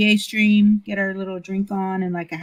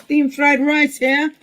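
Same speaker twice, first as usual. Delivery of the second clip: higher, slowed vendor's call; baseball game vendor; 6 semitones higher, 4 dB louder, slower than usual. Pretend. Hawking food at the baseball game, vendor call. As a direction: call it out to the ballpark stands. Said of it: "like you're at the baseball game".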